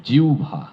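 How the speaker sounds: জিহ্বা as jiubha, clearly stressed; correct pronunciation. Shown as a demonstration. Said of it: "এটির উচ্চারণ হবে".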